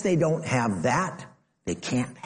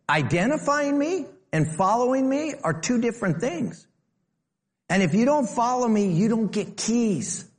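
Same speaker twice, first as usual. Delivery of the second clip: Jesus voiced; 10 semitones higher than usual. Preacher voices Jesus forcefully.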